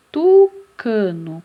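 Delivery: tucano loud and slow, long TU; emphasized first syllable. Shown as a demonstration. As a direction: punch the TU of tucano, then draw out the rest. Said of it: "Começa com qual sílaba?".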